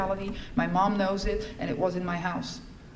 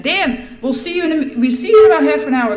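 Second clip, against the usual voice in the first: calling out; 7 semitones higher, 12 dB louder than usual. Retold or imitated. Calling instructions to Dan.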